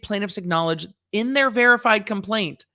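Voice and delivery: ordinary delivery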